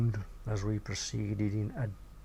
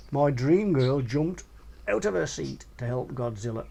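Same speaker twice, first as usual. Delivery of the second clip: normal talking voice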